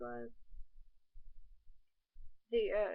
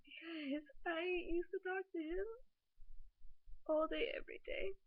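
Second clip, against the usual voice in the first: higher tearful voice; crying; 6 semitones higher than usual. Real, not acted. Missing her ex she talked to daily, crying. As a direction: speak through real tears. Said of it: "I'm gonna cry".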